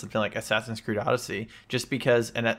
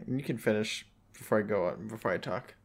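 trailing off; 7 dB quieter than usual. Real, not acted. Yielding the floor, fading.

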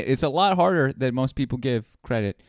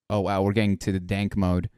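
ordinary delivery

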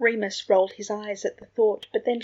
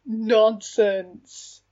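loud, hearty dismissal; jovial; 6 dB louder than usual. A narrator's reading. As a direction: wave it away with big good humour. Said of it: "his voice jovial".

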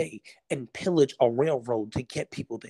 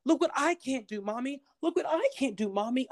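put-on high, eager little kid showing off; excited child voice; 11 semitones higher than usual. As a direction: play a child begging parents to watch, much higher. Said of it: "Not once do you hear a child say".

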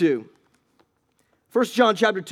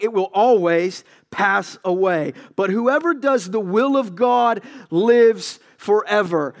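slow, emphatic declaration; preaching emphasis; 2 semitones higher, 3 dB louder, slower than usual. Acted. Preacher declaring to the congregation that the world passes away. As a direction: press each word slowly.

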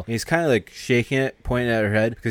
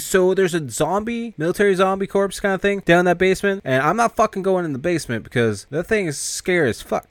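scared whine; 9 semitones higher than usual. Acted. Scared guy refusing the zombie basement, high whine.

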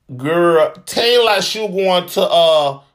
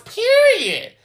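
much higher, sassy drawn-out period; city girl voice. Shown as a demonstration. Vlogger ending her point on Cynthia, in a high city girl voice. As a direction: land it like a city girl closing the case. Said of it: "In my city girl voice".

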